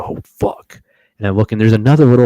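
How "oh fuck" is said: whispered curse of awe; hushed whisper at the big buck. Retold or imitated. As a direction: gasp it out in a whisper. Spotting a monster buck.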